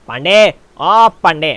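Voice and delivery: loud, slow drawn-out call. Pretend. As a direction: summon a colleague from afar. Pandey को बुलाता हूँ. (ज़ोर से खींचकर पुकारना)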